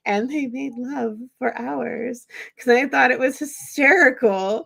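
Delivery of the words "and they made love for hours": slow, playful mock-romantic delivery; funny dramatic reading. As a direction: savour each word like a joke. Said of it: "like, very funnily saying".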